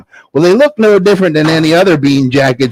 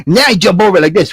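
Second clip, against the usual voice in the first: ordinary delivery, nothing marked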